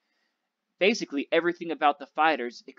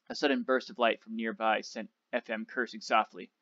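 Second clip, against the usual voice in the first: ordinary delivery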